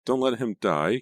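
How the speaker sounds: high, loud panicked plea; shrieking. A narrator's reading. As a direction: shriek it high, rising at the end. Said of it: "Caitlin shrieked".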